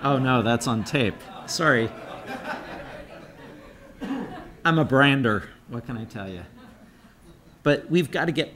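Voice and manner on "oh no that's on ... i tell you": talking through laughter; amused, laughing while speaking. Real, not acted. Laughing at own joke.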